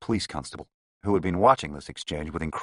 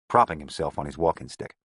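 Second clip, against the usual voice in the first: ordinary delivery, nothing marked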